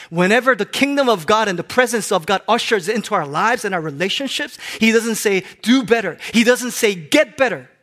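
loud, high, forceful proclamation; preaching. Real, not acted. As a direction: proclaim it to the whole congregation. Preacher repeats point at full force.

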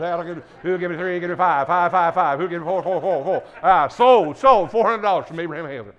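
loud rapid auction chant calling bids; auctioneer. Pretend. Mock auction for AED money.

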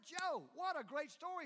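loud, high retold delight; excited praise. Friend praising Joe's great story, excited. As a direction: burst out with admiration, pitch lifted.

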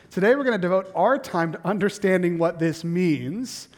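ordinary talk, nothing marked

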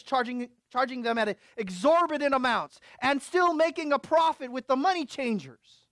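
fast, loud, high indignation; preaching at full force. Acted. Preacher listing the money changers' wrongs, fast and loud. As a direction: pour out the charges quickly.

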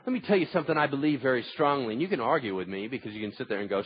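ordinary delivery

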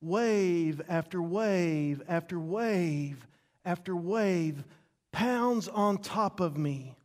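slow, heavy, repeated beats; pounding rhythm. Shown as a demonstration. Preacher rereading the verse as pounding waves, slow and heavy. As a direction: let each wave land slowly. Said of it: "another way we could read this".

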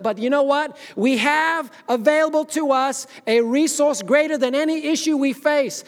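loud, high, impassioned pulpit cry; full-force preaching. Real, not acted. Preacher telling the church of resurrection power, full-force preaching. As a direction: preach it at the top of your voice.

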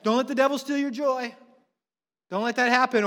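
hammed-up church cheerleading; mock encouragement. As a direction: push forced positivity at someone who is down. Mimics Christians' upbeat platitudes.

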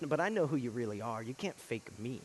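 soft, low rebuke; hushed voice of Jesus. Acted. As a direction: lean in and say it softly. Jesus' quiet rebuke in sermon.

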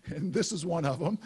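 said through a real laugh; amused. Real, not acted. Preacher introducing a family story to church, laughing. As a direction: chuckle as you admit it.

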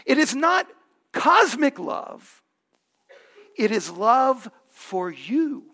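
slow, loud, weighty words; emphatic preaching. Acted. Pastor telling listeners God's love is personal, slow and emphatic. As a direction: lean on every word.

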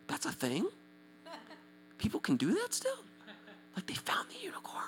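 high, soft disbelief fading to whisper; awed, barely voiced; hushed amazement. To someone explaining tongues, hushed mock amazement.

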